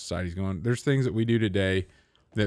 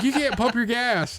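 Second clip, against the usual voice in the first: high-pitched mock attendant with a laugh; raised, laughing parody voice; 13 semitones higher, 3 dB louder than usual. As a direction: squeak it out like a scolding attendant. Attendant quoted.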